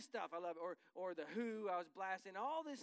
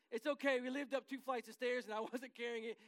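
normal talking voice

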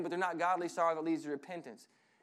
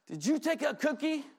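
higher accusing parent question; mock shocked parent; 8 semitones higher than usual. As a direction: catch the kid red-handed. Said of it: "they say".

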